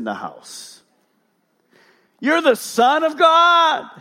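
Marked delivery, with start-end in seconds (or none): 2.2-3.9 high, loud, drawn-out cry; demon crying out. Acted. Demon naming Jesus as God's Son, crying out. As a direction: shout it slowly, pitched way up.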